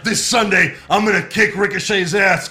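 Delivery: loud shouted trash talk; wrestling promo. Retold or imitated. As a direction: cut a promo like a heel. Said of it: "trying to cut a promo".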